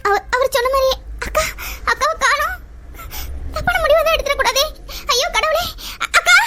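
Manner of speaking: high, tearful alarm; panicked. Acted. Roja can't find her sister and fears the worst, panicked. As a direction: cry out in rising dread, voice climbing.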